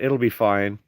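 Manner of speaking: ordinary talk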